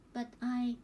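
plain reading voice

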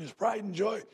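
usual speaking voice

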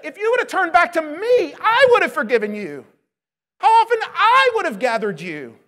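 a loud, impassioned plea in Jesus' voice; Jesus, pleading loudly. Acted. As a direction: cry out as Jesus longing for his people. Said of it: "But what does Jesus say?".

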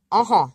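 uh-huh with a nasal O, ah through the nose. Shown as a demonstration. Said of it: "And that is said".

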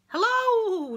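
high, loud friendly hello; cheerful llama hello; llama greeting. Acted. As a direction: greet the couch brightly. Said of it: "Llama bayed".

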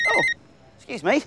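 ordinary talk

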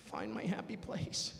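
slow, self-soothing chant; slow calming mantra to himself. Pretend. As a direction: talk yourself down slowly. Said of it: "calming himself mantra".

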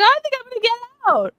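high, loud and fast mock optimism; mock hopeful trapped man. Mocking the trapped man telling his wife he'll escape, high and fast. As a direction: squeak it out like a clueless optimist. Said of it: "he's like".

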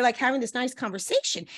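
ordinary talk, nothing marked

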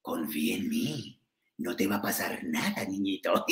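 put-on sly serpent coaxing a child; snake Kaa. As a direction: lull the child like the jungle book snake. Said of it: "le decía a la víborita".

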